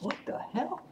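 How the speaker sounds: high, soft startled question; a quick, high surprised gasp of a line; startled guard. Retold or imitated. A guard spots Carl.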